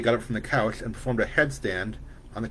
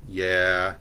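elongated yeah; 3 semitones lower than usual. A narrator's reading. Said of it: "an elongated".

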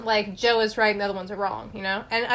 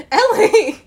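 loud, high call of her name, half scolding; exasperated call; 9 semitones higher, 9 dB louder than usual. Retold or imitated. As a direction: call out her name like a friend who can't believe it. Friend calls her name, exasperated.

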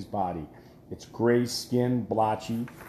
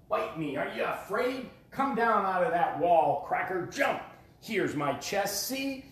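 taunting roar; 7 semitones higher, 4 dB quieter than usual. Acted. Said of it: "he roared at it".

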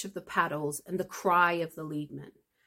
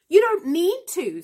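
sharp, loud and high snap; snapping; 12 semitones higher, 10 dB louder than usual. A narrator's reading. Mister Frank snapping at Granny over the pot, loud. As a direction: bark it back at her, much louder. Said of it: "snapped Mister Frank".

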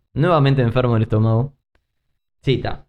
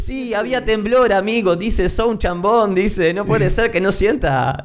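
teasing friend; 9 semitones higher than usual. Retold or imitated. Friend teasing him about quake.

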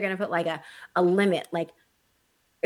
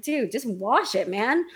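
exasperated scolding; 5 semitones higher than usual. Retold or imitated. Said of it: "I'm like".

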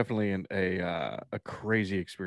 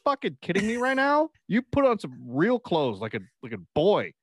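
high-pitched, incredulous scolding; mock appalled mom; 9 semitones higher than usual. Imagined mom reacts to son's midriff shirt, mock incredulous outrage. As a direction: act out a mother's outraged disbelief.

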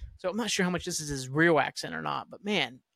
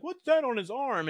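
higher-pitched, mocking imitation of a dim captain; mock dumb question; 7 semitones higher than usual. Host mimics captain distracted by the gadget, higher mock-dumb voice. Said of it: "And then being dumb".

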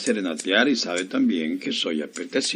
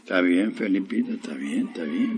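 softer, low placating mumble; meek compliance; 3 semitones lower, 5 dB quieter than usual. Acted. Barber obeys his scolding wife, meek compliance. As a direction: give in, getting quieter with each repeat. Henpecked husband gives in to wife.

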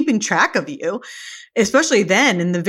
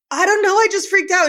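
mock crying girl; 10 semitones higher, faster than usual. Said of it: "And she's like".

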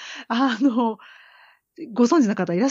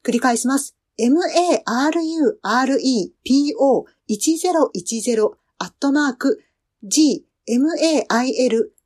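announcer read; 5 semitones higher than usual. Acted. Reads the mail address and outro announcement.

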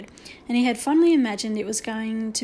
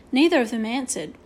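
ordinary delivery, nothing marked

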